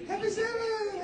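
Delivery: very high, loud, bright-faced "Happy Sabbath!" put on over hurt; hammed-up church greeter cheer; fake cheerful greeting. Preacher mimics the fake Sabbath greeting, hammed-up and cheerful. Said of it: "I put on the happy face".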